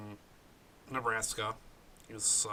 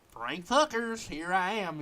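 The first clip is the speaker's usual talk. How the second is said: high, punchy infomercial self-introduction; infomercial pitch; 6 semitones higher than usual. Acted. Guest introduces himself as if selling, infomercial pitch. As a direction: announce your own name like a TV pitchman. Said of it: "sounds like I should have, like, an infomercial".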